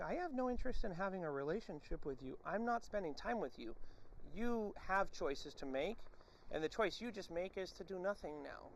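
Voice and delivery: measured refusal to engage; calm dismissal. Acted. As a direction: quietly lay down the boundary. Acted firm dismissal of the dog.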